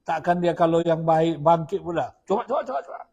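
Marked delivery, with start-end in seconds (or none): 2.3-3.0 high mocking go on then; retold wife daring her husband; taunting dare. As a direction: goad him to try it.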